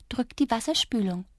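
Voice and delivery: a small voice giving a quiet order; softly commanding creature. A narrator's reading. Tiny Drups commands the girl, soft but firm. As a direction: order her quietly to flush. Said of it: "kommandierte er".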